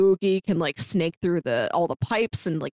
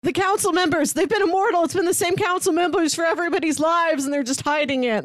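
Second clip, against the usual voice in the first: put-on high, raised voice of a raving old man, pitched up; a hint of a paranoid old-timer ranting, pitched well up; conspiracy rant; 8 semitones higher than usual. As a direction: rant like the town kook, voice lifted high. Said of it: "and he's like".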